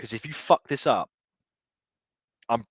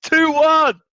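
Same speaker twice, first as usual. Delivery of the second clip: screaming at the top of his lungs, much higher; ecstatic shout; 14 dB louder, much slower than usual. Real, not acted. Fan celebrating his team's goal on stream, ecstatic screaming. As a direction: roar the goal like you're in the stands.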